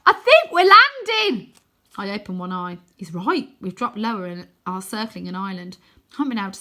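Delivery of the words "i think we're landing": high, loud, slow shout. Acted. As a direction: call it out over wind. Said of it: "Wynne has to shout".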